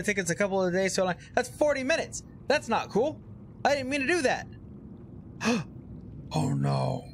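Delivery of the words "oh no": gasp and whisper. Real, not acted. Ship crashes, hushed dread.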